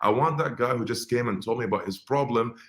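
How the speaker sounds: ordinary delivery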